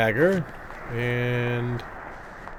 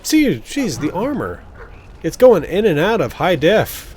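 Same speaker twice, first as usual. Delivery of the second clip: higher and louder, exasperated at a glitch; exasperated exclamation; 7 semitones higher, 5 dB louder, slower than usual. Real, not acted. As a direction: exclaim it in exasperation, pitch jumping up. Pointing out a graphics glitch.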